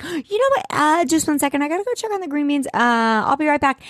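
high, rushed nervous impression; mock-flustered, high-pitched stalling; flustered excuse. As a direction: babble a hasty excuse up high. Flustered Seth makes an excuse.

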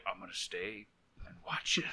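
whispered, eerie and soft; creepy whisper. Pretend. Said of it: "he's just like".

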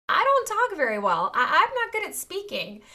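a hint of timid Moses, laughing. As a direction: laugh through Moses's excuse. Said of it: "He's like".

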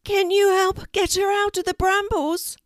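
high, loud bird voice; a pleading talking raven. A narrator's reading.

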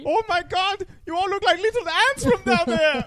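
high, loud, excited caterpillar voice; Heimlich. Acted. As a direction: gush like a newly winged caterpillar. Voicing Heimlich as a butterfly.

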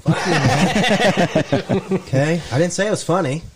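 laughing comeback to a friend who called the joke unfunny. Real, not acted. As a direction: laugh through the mock outrage at a friend. Laughing protest at friend's jab.

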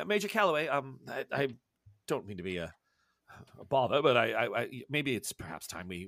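low, soft, halting gentleman scholar; hesitant professor, politely deferring. Acted. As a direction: stammer apologetically, keeping it low and soft. Plays hesitant professor in scene.

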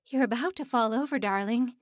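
fast, loud motherly insistence; urgent, won't take no; insistent. A narrator's reading. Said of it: "Missus Stern insisted".

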